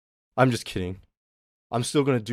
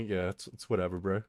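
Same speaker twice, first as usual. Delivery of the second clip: low, hushed, offhand dismissal; nonchalant; 6 semitones lower, 11 dB quieter than usual. Acted. Streamer playing cool about it to chat, nonchalant. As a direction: toss it off softly, like it doesn't matter. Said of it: "just, like, nonchalantly".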